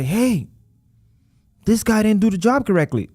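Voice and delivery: new client griping about a failed repair; complaining client. Retold or imitated. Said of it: "they do come and say".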